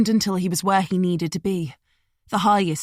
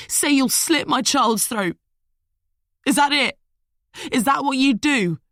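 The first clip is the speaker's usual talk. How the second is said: furious tirade; 6 semitones higher, 4 dB louder than usual. A narrator's reading. Liam raging at Smith from the branch. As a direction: spit every word at them.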